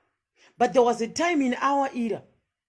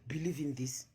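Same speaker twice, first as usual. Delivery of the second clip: whispered, mocking the missionary; a mocking hushed missionary line; 7 semitones lower, 14 dB quieter than usual. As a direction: hiss it like a sly preacher. Mocks the missionary's preaching.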